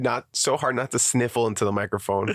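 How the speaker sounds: stuffy sick voice. Real, not acted. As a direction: talk through a cold. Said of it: "I'm sick".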